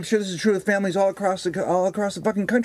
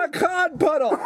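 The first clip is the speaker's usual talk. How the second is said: shouted punchline; 12 semitones higher, slower than usual. Acted. Repeating his joke punchline to his co-host, shouting. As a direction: belt the pun out triumphantly.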